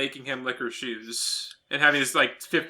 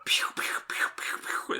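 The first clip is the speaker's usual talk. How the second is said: whispered pew pew gunfire; soft mouth-made gunshots; mock gun sounds; 9 dB quieter, faster than usual. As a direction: breathe out little laser shots. Imitating gunshot sound effects.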